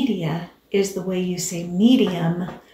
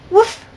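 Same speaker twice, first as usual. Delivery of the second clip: dog woof; 11 semitones higher, 7 dB louder than usual. Acted. Dog answers again.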